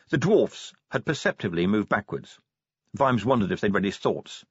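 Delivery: ordinary delivery, nothing marked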